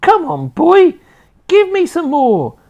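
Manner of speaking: very high, very loud screechy crone whoop; wild old woman's shout. A narrator's reading. Grandma rising through the floor, screeching with glee. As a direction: whoop with mad joy. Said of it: "she yelled".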